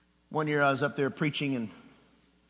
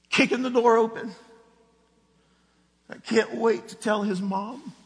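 slow preaching; 6 semitones higher, 4 dB louder, slower than usual. Acted. Sermon point drawn out.

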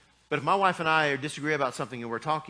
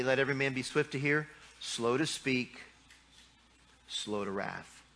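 scripture reading; 5 dB quieter than usual. Real, not acted. Preacher quotes scripture.

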